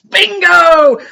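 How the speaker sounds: high, loud triumphant bingo; gleeful shout. Retold or imitated. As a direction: shout it like a win. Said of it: "So anyway".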